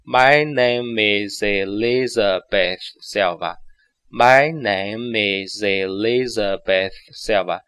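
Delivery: slow, evenly linked; slow read-along. Shown as a demonstration. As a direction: model it slowly for repeating. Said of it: "你先慢慢地读几遍". (慢慢带读)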